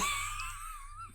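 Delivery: a high squealing laugh; high shrieking laugh. Real, not acted. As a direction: burst into a shrill laugh. Laughing at own impression.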